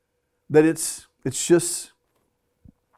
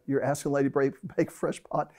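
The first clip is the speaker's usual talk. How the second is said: low, softer incredulous aside; husband quietly questioning his wife at McDonald's; disbelieving aside; 3 semitones lower, 8 dB quieter, faster than usual. Retold or imitated. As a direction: lower the voice in disbelief. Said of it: "And I'm like".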